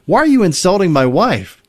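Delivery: lightly acted upset demand; upset groom. Groom confronts best man at the wedding, mildly acted upset. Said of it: "got really upset and was like".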